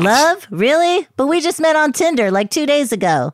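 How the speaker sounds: surprised. Pretend. Shocked at love confession.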